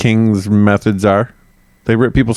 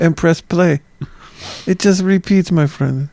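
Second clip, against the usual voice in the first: high put-on Mayan accent; Mayan impression; 7 semitones higher than usual. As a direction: finish in a high mock-Mayan voice. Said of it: "That's my Mayan impression".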